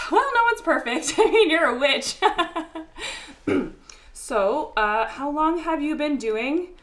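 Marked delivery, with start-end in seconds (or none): 0.1-3.7 high, loud nervous babble breaking into laughter; nervous laughing. Acted. Guest joking awkwardly with a witch, nervous laughter. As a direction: joke too loudly out of nerves. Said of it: "I'm nervous".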